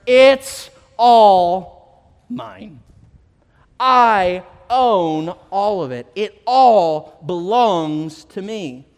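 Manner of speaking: very slow, loud and solemn; God's declaration. Acted. God declaring ownership of the world, slow solemn proclamation. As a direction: stretch every word like a decree from heaven. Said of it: "God declares".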